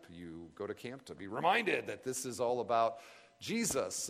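ordinary talk, nothing marked

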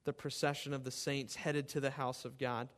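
rising to loud pleading on the cries; impassioned scripture reading. Acted. Pastor reading the psalm to the congregation, fervent. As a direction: read the psalm like a prayer cried out. Reads psalms aloud with fervour.

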